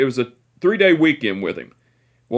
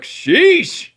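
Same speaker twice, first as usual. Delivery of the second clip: high, stunned mock exclamation; sheesh; 13 semitones higher than usual. Grandson reacting to great-grandpa's bossy order, high stunned sheesh. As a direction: let it out in disbelief.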